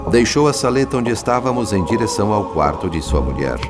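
normal talking voice

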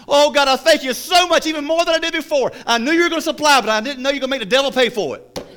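high, loud, exultant shout to God; imitated ecstatic praise. Woman thanking God despite the atheist, ecstatic high shouting. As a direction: build a triumphant mock prayer to the punchline.

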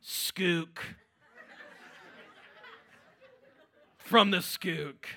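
chuckling through a slow drawn-out Skook; amused, teasing the locals; laughing at his own joke. Real, not acted. Joking about Schuylkill.

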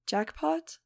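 high, strange questioning call; a ghostly voice teasing from nowhere; eerie mystery voice. A narrator's reading. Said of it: "I heard the voice of Doctor Guntherhagen".

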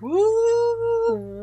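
high, loud yelp of a woo; a mock spooked whoop. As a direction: yelp it out, half thrilled, half spooked. Reacting to spooky news.

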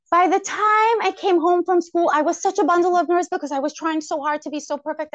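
rising pitch and volume as the stress builds; wound up and tense. Real, not acted. Wound-up retelling. Describing nerves after school.

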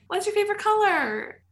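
put-on high, sing-song voice for a small child; judge's question to a kid. Pageant judge asking a small child, high and sing-song. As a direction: ask it like a judge talking down to a five-year-old.

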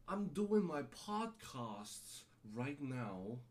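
very slow, drawn-out snobby voice; mock rich snob. Host imitating a rich asshole doing a podcast, mock snobby. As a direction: stretch it out like a stuck-up rich snob. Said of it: "You're a rich asshole".

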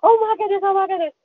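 high, breathless oh my goodness; repeated excited exclamation; thrilled disbelief. Retold or imitated. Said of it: "I'm shaking".

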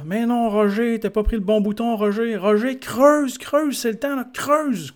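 high, slow, hammed-up yelling at Roger; mock foreman yelling. Foreman shouting at Roger in his cab, hammed-up high yell. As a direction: bark orders like a bossy foreman in the cab.

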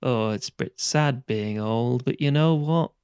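put-on slow, doleful old man's monologue; wistful old fellow from a British stage play; Alan Bennett old man. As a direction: play a self-pitying old man, taking it slowly. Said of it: "an Alan Bennett sort of play".

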